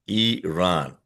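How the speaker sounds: Iran said "ee-RAHN", slowly; correct pronunciation of Iran. Shown as a demonstration. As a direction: say Iran as ee-RAHN, long open second vowel. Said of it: "it is pronounced as".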